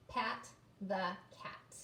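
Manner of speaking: slow "pat the cat"; slow word reading. Shown as a demonstration. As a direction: point out the word clearly. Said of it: "there's our word too".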